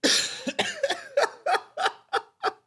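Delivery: high breathless burst of disbelieving laughter; stunned laugh. Real, not acted. Reacting to the trial video, a shocked high laugh. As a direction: laugh in shock, half against your will. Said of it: "Yo, I'm sorry for the laugh".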